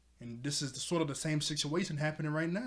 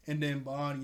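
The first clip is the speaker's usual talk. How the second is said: ordinary delivery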